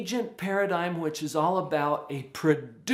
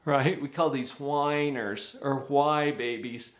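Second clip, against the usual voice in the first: usual speaking voice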